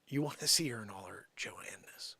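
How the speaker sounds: soft teasing whisper. Real, not acted. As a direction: whisper the tease. Teasing aside.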